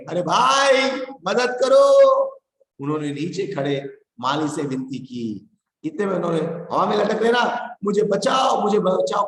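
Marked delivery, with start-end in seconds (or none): 0.1-1.8 high, loud plea; stuck man shouts for help; shouting for help. Acted. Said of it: "Bhikhu भाई चिल्लाने लगे". 6.7-9.2 high, loud cries to be saved; man in tree begs gardener; pleading cry. Acted. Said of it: "कहकर उन्होंने किससे विनती की".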